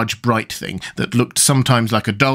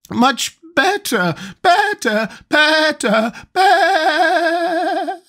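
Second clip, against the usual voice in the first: very high squeal ending in long sheep bleat; squeak into bleat; much slower than usual. A narrator's reading. Queen turning into a sheep, squeaking then bleating. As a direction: climb to a squeak, then stretch the last into a bleat. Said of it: "her voice rising to a squeak".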